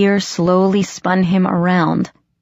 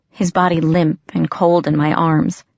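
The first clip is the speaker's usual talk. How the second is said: ordinary delivery, nothing marked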